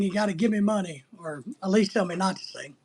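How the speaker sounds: normal talking voice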